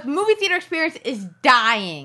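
loud, high, theatrical lament; mock outrage. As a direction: complain loudly to the whole cinema, hammed-up.

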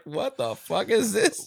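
high, slow, through laughter; laughing disbelief. Retold or imitated. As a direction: crack up while asking in bafflement. Cracking up retelling his reaction.

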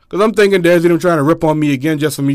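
ordinary talk

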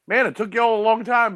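high-pitched mock North Carolina husband; exaggerated high North Carolina twang; North Carolina accent. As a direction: imitate a Southern husband way up high. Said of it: "her husband has one".

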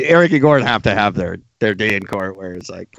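usual speaking voice

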